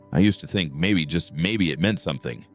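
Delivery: ordinary delivery, nothing marked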